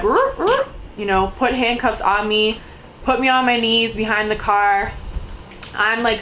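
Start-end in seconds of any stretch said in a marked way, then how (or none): 0.0-0.7 high mock grunting; growling cop noises. Cops grabbing her, mock grunting. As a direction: make rough scuffling noises. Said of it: "they're like".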